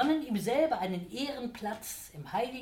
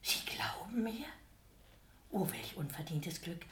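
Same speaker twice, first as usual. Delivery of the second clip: 1 hushed mock gratitude; 2 semitones lower, 5 dB quieter than usual. A narrator's reading. Devil's feigned humble gratitude.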